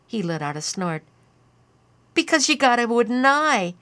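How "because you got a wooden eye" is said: higher, louder giggling taunt; a tipsy kid cracking himself up; giggly boy. A narrator's reading. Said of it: "began to giggle".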